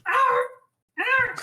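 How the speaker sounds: high imitated bird squawks; short, high, half-serious bird cries; bird noises. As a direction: squawk like a bird, badly faked. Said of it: "Bird noises".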